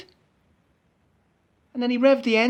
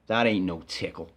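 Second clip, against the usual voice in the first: gruff bus driver; 12 semitones lower than usual. Retold or imitated. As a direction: drop low and drag it out like a stern driver. Driver voiced in retold quarrel.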